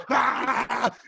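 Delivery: high strained yelp; one yelp of a dog pulling free; dog's yelp. Retold or imitated. Imitating a dog ripping out of its collar, high yelp. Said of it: "And he just goes".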